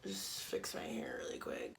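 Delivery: whispered aside. Real, not acted. Pausing the story time to fix her hair, whispered. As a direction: whisper it to yourself mid-story.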